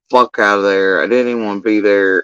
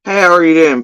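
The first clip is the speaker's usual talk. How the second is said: mock cheerful caller; 6 semitones higher, 5 dB louder, faster than usual.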